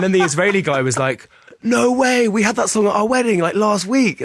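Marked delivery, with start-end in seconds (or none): 1.6-4.2 much higher, eager "no way"; excited disbelief. Retold or imitated. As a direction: gush like a delighted fan. Israeli guy excited about wedding song.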